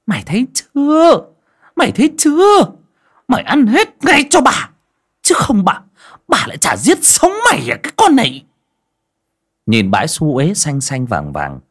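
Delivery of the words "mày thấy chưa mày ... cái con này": high, loud, seething threat; enraged screech. A narrator's reading. Stepmother shoves girl's head down, screeching threats. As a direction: screech it furiously through the teeth. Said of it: "miệng rít lên".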